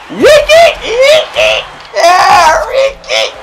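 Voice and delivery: high squealing rim-sound imitation; imitating the rim. Showing viewers how the rim sounds, high squeal. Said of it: "That's how the rim sound".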